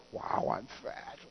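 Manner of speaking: whispered, slow mock dismay; dejected whisper. Woman at mirror whispering about her weight. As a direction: breathe it out in defeat. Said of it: "women are going".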